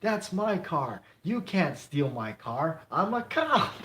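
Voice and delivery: high, slow, hammed-up shout of protest; mock outraged cop yell. Fan retelling movie scene, hammed-up outraged cop yelling. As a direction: yell it like the cop catching a car thief. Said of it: "comes running out and goes".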